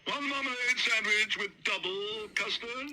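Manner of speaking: high, slow called-out order; calling the order. Acted. Waiter calls Sir Huntley's order to the kitchen. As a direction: call it to the kitchen.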